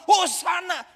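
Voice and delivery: loud hosanna; crowd acclaim. Retold or imitated. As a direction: call it out like a cheering crowd. Crowd hailing Jesus.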